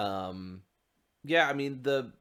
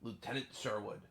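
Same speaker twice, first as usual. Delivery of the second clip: put-on softer letter reading in a pirate's British accent; 7 dB quieter than usual. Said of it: "gonna read in a pirate's accent".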